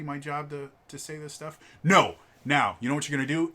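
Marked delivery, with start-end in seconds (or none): 1.8-3.5 louder, bossy cut-off; forceful dad. Retold or imitated. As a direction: take charge and interrupt the clerk. Dad cuts off the salesman.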